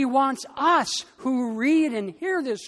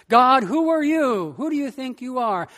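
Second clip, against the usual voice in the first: defiant complaint; 3 semitones higher, 5 dB louder than usual. Retold or imitated. Suffering people challenge God.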